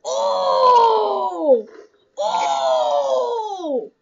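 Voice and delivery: high shouted "oh", falling away; long loud yell sliding down; loud drawn-out cry. Real, not acted. Gamer reacting to his game, loud falling cry.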